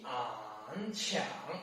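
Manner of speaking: "an" and "qiang" dipping low, then rising; soft, low scoop on "qiang"; third tone. Shown as a demonstration. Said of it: "third tone".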